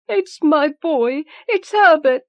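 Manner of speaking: high frantic crying out; desperate cry. Acted. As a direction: plead wildly for her boy. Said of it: "she cried".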